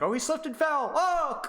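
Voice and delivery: high, hammed-up cry of a kid who slipped; playful over-the-top yelp; mock pain. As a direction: ham up the fall for the kids. Acting out kids' violent story.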